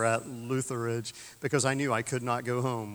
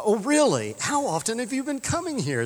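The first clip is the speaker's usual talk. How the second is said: surprised tourist; 10 semitones higher, 3 dB louder than usual. Retold or imitated. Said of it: "they, they asked".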